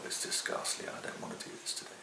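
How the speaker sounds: almost whispered, under the breath; muttered to himself. Retold or imitated. A carpenter on a bad workday mutters under his breath. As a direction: grumble it to yourself, barely voiced. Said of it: "there are days when you think".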